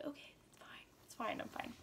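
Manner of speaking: whispered self-reassurance; nervous whisper. Retold or imitated. Teen home alone calming herself, in a whisper. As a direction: whisper it to calm yourself down.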